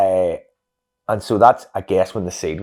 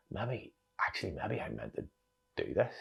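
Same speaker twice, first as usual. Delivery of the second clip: hushed inner thought; 12 dB quieter than usual. Retold or imitated.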